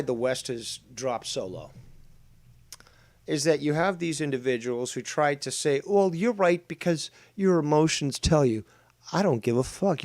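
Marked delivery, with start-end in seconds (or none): 5.9-8.6 sneering parody of an opponent; mock whiny tone. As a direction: mimic them with contempt. Mocking opponents' emotional reasoning.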